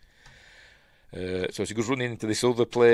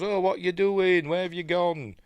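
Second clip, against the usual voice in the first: high imitation of a worried film heroine; mock puzzled calling; 9 semitones higher than usual. Woman to vanished boyfriend, mock bewildered calling. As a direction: call out in a put-on higher voice.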